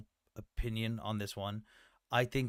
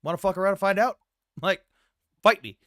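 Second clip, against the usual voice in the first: loud, higher, cocky taunt; mock brash Israel; 7 semitones higher, 8 dB louder than usual. Speaker voicing Israel daring its enemies, loud cocky taunt. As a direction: shout it like a country itching for a fight. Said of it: "if Israel went".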